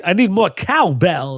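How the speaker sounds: high, hammed-up celebrity impression; slow mock impression with odd cadence; overdone Christopher Walken. Showing how others always do it, mock Walken. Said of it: "how they're always trying to do it".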